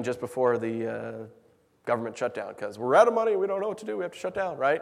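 ordinary delivery

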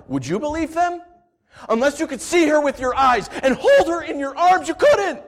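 high, loud, ending in a shout; passionate appeal. Acted. Narrator challenging listeners, rising to a loud shout. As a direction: drive the point home to them.

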